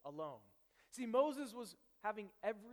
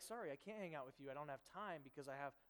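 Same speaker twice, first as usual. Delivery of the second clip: brushing off; 5 semitones lower, 6 dB quieter, faster than usual. Retold or imitated. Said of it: "I'd say, like".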